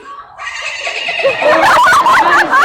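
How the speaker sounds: very high, loud squealing laugh; long piercing dolphin-like cackle; imitated dolphin laugh. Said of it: "like a dolphin caught in a tournament".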